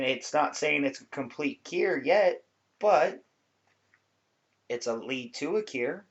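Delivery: ordinary talk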